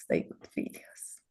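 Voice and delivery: "they good videos" whispered, almost no voice. Retold or imitated. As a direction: breathe it out as a grudging whisper. Repeated inner thought, whispered.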